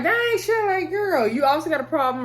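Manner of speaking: high-pitched, taken-aback exclamation; incredulous reaction. Real, not acted. Vlogger reacting to a reality show woman, incredulous. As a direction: call her out in a high, disbelieving pitch.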